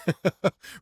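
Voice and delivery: short light laugh; quick little laugh; amused chuckle. Real, not acted. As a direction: chuckle briefly at your own point. Amused by own pronunciation demo.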